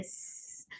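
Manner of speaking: स- as a long voiceless hiss of air, no voice; whispered sibilant hiss demo; airy s hiss. Shown as a demonstration. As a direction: push air through the teeth on स-. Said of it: "अगर हवा की sound सुनाई दे".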